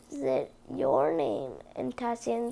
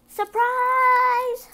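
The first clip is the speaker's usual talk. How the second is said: very high, loud squeaky mouse voice; mice crying surprise; 11 semitones higher, 8 dB louder than usual. Acted. The mice reveal the dress to Cinderella, squeaky and loud. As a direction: squeal it with delight. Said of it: "her friend cried".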